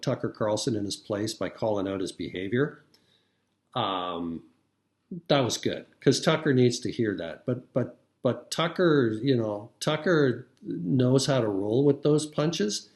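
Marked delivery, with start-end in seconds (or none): none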